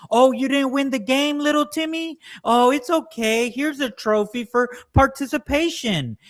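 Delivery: put-on high, sing-song baby-talk mom; coddling parent. Parent consoling little Timmy after losing, in high cooing tones. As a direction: coo over him like a spoiled toddler.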